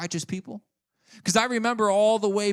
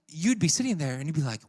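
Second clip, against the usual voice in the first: ordinary talk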